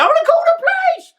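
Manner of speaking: imitating her screaming for the police; mock scream. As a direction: shriek it like the panicked girlfriend. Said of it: "She's screaming up and saying".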